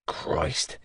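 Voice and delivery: soft, low whisper; whispered aside; breathy whispered musing. Acted. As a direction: breathe it out, half to yourself. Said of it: "I mused".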